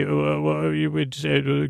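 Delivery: ordinary talk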